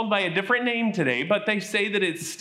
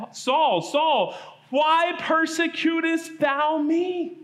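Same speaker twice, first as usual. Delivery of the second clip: a lightly raised, slow calling voice from the sky; voice of Jesus; 8 semitones higher, much slower than usual. Acted. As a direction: call his name down from heaven, slow and solemn. Voice from the sky calls Saul.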